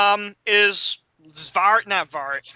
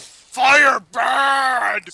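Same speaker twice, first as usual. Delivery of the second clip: loud, drawn-out monster cry; Frankenstein; 3 semitones higher, 5 dB louder than usual. Acted. Game master echoes the Hotel Transylvania line, monster bellow. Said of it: "Frankenstein said in, uh, Hotel Transylvania".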